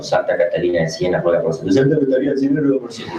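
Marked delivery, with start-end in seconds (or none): 1.8-3.2 higher, sing-song parody ending in a laugh; mock the prayer like a jeering demon; sarcastic mockery. Demon mimicking the priest's litany, sarcastic sing-song. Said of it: "son chistes sarcásticos".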